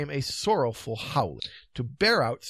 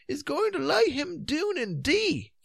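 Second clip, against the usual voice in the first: high, slow Scottish lilt; old Scots folk. A narrator's reading. Old ones watching the knight collapse, high Scottish. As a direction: draw it out in broad Scots. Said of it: "said a number of old ones".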